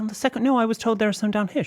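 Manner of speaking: high, incredulous pushback; baffled protest. Retold or imitated. Customer protesting to staff, high and baffled. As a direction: voice jumps up in disbelief. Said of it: "I said".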